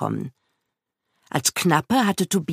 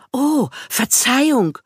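high, slow, drawn-out apology; apologetic squire; 9 semitones higher than usual. A narrator's reading. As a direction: squire begging pardon, pitched well up. Squire apologises to angry knight. (Knappe, der hoch und gedehnt um Verzeihung bittet)

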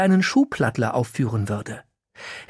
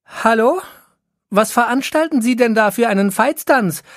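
high-pitched, indignant call; 8 semitones higher, 4 dB louder than usual. A narrator's reading. Ilka calling up to the fireman on the dike, indignant call. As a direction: call up to someone in a raised, questioning voice. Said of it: "rief Ilka hinauf".

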